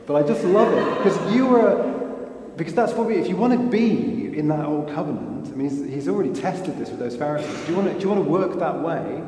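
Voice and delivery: loud, high, fervent preaching; preaching at full force. Real, not acted. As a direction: drive it home to the congregation, loudly.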